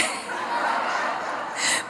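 soft breathy laugh. Real, not acted. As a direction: chuckle quietly at the punchline. Laughs at punchline.

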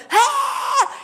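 loud, high imitated bawl; hammed-up cry of pain; mock child's wail. Mother imitating her bee-stung son, loud high wail. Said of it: "and he's going".

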